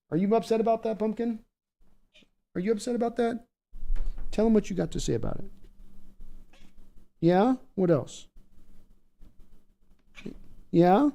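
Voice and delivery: high, soft pet-directed voice; talking to the dog. Real, not acted. Talking to his dog Pumpkin.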